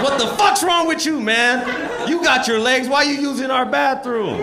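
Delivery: hammed-up furious old man; mock angry yell. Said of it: "He goes".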